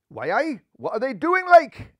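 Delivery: Geordie bunny, rising high and excited; curious Geordie lad peeking from the bushes; Geordie accent. Acted. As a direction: ask it in broad Geordie, pitch climbing. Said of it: "inquired Milburn".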